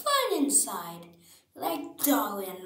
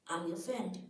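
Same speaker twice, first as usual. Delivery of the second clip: ordinary delivery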